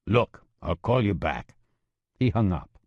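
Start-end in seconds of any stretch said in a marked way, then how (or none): 0.0-1.5 gruff voice. Acted. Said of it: "the man with the gruff voice said".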